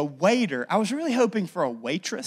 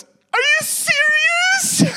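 very high, slow, loud squeal of disbelief; shocked disbelief; 8 dB louder, much slower than usual. Retold or imitated. Fiancée stunned by the proposal, shrieking high disbelief. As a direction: shriek it like you can't believe it. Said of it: "she goes".

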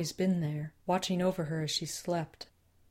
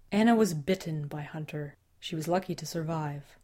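plain reading voice

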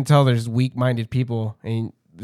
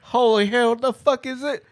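confused bum voice; 11 semitones higher, 4 dB louder than usual. Retold or imitated. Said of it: "be like".

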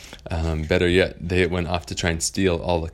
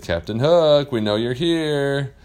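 higher, louder calling out to the hider; boys calling out; 7 semitones higher, 6 dB louder, slower than usual. Acted. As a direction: challenge the hidden pirate. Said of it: "they said".

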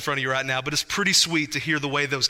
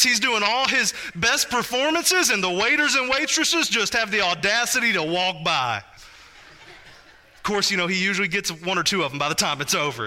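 ordinary delivery

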